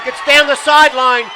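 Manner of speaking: loud, rising play-by-play shout; excited call. Real, not acted. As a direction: call the big run as it breaks. Big run, live call.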